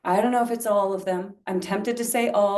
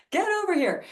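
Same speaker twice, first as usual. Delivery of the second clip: pitched way up, calling someone over; eager high call to come over; beckoning call; 11 semitones higher, much faster than usual. Retold or imitated. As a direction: summon them to come close. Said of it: "Ya is like".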